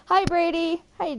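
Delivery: high-pitched greeting call; high, eager hailing voice; calling out hello. Real, not acted. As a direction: sing out a hello to someone. Greeting Brady once more.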